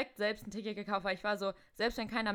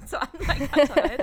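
laughing; 5 semitones higher, 11 dB louder, much slower than usual. Real, not acted. Happy ending to the story.

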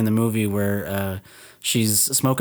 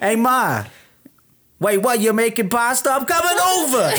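much higher hammed-up New York accent, laughing at the end; New York Italian guy; 9 semitones higher than usual. Phoning his ma about pasta, hammed-up New York accent. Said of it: "That's New York".

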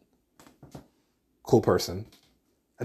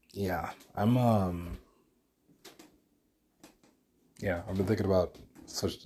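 drifting off-mic while pacing; pacing near and far; 2 semitones lower, 9 dB quieter than usual. Real, not acted. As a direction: voice fading as he walks away. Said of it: "but I'm pacing right now".

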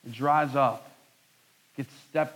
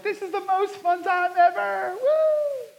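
very high, gushing party voice; mock influencer; slower than usual. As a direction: squeal it like a carefree influencer on a boat. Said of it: "and like".

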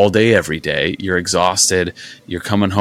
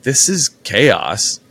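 slow deadpan; 4 semitones higher, much slower than usual. Retold or imitated. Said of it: "thinking, and I'm like".

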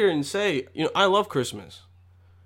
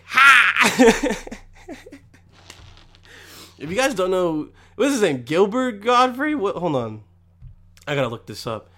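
ordinary talk, nothing marked

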